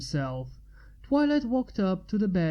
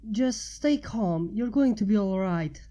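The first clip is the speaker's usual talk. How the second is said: calm reassuring; 2 semitones higher than usual. A narrator's reading. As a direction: soothe him, keep calm. Twilight comforts injured man.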